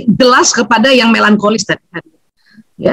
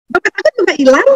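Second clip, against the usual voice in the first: higher, bright mock-cheerful telling; cheerful despite loss; 7 semitones higher, faster than usual. A sanguine telling of her lost wallet, laughing. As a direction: say it like it's funny news. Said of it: "tapi mukanya ketawa".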